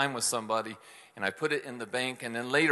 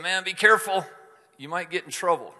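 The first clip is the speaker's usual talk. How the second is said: louder, higher cautionary voice; a worried writer cautioning his friends, raised voice; stern warning; 6 semitones higher, 6 dB louder, faster than usual. Retold or imitated. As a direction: warn them to be careful. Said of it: "That's how he's talking".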